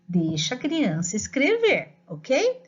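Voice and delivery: normal talking voice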